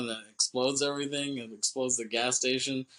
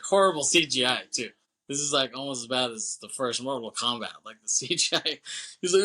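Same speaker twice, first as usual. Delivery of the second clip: ordinary talk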